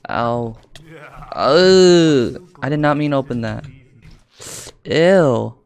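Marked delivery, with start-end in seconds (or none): 1.3-2.5 long, high, loud "ohh"; drawn-out cry of dismay; alarmed cry. Real, not acted. Accidentally opened something, recoils. 4.8-5.6 loud, high "ew" of disgust; real burst of revulsion. Real, not acted. Disgusted by what appears.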